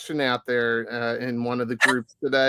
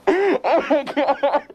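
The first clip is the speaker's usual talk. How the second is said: laughing; 12 semitones higher, much slower than usual. Real, not acted. Laughing hard after intro.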